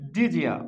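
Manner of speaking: "ডিজিয়া" with d and y fused into j; linked pronunciation. Shown as a demonstration. Teacher gives the resulting pronunciation, "ডিজিয়া" with a j link. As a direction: run it together as di-jia, "ডিজিয়া". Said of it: "এটাকে কি বলতে পারি".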